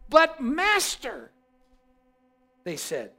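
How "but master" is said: higher, slow, pleading "But master"; protesting servants. Acted. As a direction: object in disbelief.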